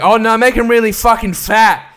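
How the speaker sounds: over-the-top mocking cheer; mock loud jeering. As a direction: bellow it like a leering cheerleader. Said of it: "they're just like".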